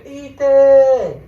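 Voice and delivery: high, loud frantic call into the dark; mom yelling a name. Retold or imitated. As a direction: call out for a lost child at full voice. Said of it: "she's just screaming my name".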